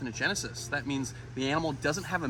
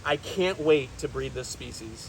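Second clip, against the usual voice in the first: usual speaking voice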